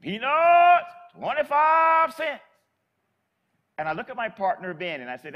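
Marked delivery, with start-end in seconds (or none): 0.0-2.4 loud, high, slow street-vendor cry; drawn-out hawker's shout; vendor's call. Retold or imitated. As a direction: call it out like an old peanut seller. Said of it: "She stops".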